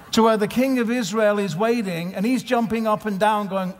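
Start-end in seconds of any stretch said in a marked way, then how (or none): none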